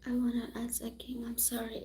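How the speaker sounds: whispered apology; hushed, barely voiced sorry. Real, not acted. Apologising to a viewer in a whisper.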